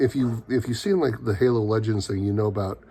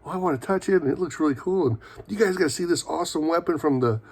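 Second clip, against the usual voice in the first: excited gushing; 6 semitones higher, faster than usual. Retold or imitated.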